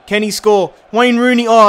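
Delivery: real hype as the kick goes; excited shout. Real, not acted. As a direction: call the shot as it flies. Free kick being taken.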